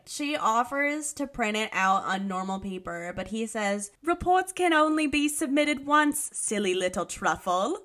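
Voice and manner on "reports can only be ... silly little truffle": louder, hammed-up British teacher; British accent. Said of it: "make me do this in an accent".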